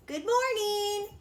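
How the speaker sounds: high, bright greeting called out; cheerful call. A narrator's reading. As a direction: call out happily to the animals. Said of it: "she called to the rabbits".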